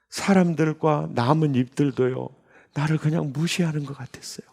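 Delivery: usual speaking voice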